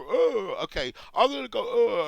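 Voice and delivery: high, loud mock outrage; a put-on shocked "oh"; mock shocked gasp. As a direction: ham up a shocked reaction. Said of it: "thrown up to make us go".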